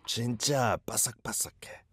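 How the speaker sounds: fading to a whisper, copying the clip; imitated whisper. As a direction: whisper it away as if being eaten. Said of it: "I like the volume decreasing".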